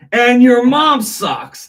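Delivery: very high, loud and slow jab; the parrot mocking its owner, shrill squawky insult. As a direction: screech it like a cheeky bird. Parrot's cheeky final insult.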